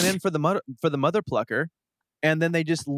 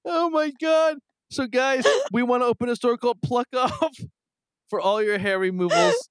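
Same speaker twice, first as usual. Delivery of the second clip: after laughing hard; 8 semitones higher than usual. Real, not acted. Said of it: "I'm crying".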